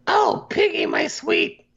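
very high, swooning falsetto; Gonzo impression. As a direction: coo it like a lovesick hero. Voicing Gonzo rescuing Piggy.

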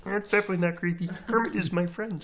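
high, thin frog puppet voice; squeaky Muppet frog; Kermit the Frog voice. Pretend. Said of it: "maybe I'll just be someone else".

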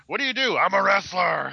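hammed-up wrestler interview; mock wrestler shout. Mock interview with Sergeant Slaughter.

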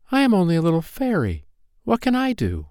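put-on high, plaintive child voice; helpless little fairy. A narrator's reading. Poppy Pink answering Wonder Wings, high and helpless. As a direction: protest high, as one too small.

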